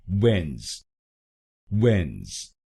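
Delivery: वेंस said as wenz, one syllable; वेंस with no D and no middle vowel; syllable demo. Shown as a demonstration. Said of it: "वेडनेस नहीं बोलेंगे".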